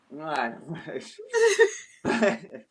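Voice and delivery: a laugh breaking into tears; teary laugh. Real, not acted. Retelling the psychic story, a teary laugh. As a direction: laugh through rising tears.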